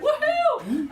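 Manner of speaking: high, laughing cheer of delight; laughing whoop. Real, not acted. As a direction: whoop it up at your own joke. Cheering her own joke.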